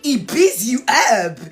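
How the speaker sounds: slow, wailing Ghanaian preacher parody; mock Ghanaian preacher accent. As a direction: draw it out loudly in a hammed-up Ghanaian accent. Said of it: "this is my favorite one".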